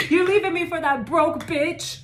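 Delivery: mock jealous rant. Reader voicing a jealous ex, mock outraged yell. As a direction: scream it like a jilted ex.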